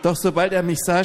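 ordinary delivery

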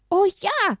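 high, loud eager cheer; high happy child yell; excited. Acted. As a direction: jump at the idea.

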